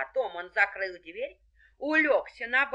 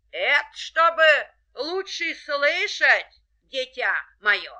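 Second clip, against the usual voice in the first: sly wolf; 7 semitones higher, 9 dB louder, slower than usual. A narrator's reading. Wolf answering as granny.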